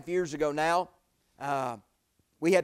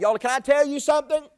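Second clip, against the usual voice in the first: preaching call; 9 semitones higher, 9 dB louder than usual. Acted. Preacher calls to congregation.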